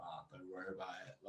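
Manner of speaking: low, mumbled brush-off; imitating Davey. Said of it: "Davey will be like".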